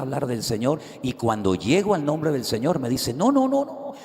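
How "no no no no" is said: high, loud retold shouting; shouted no. Young man in the market shouts at the preacher, high and loud. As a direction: cry out the nos in alarm. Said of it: "pero a gritos".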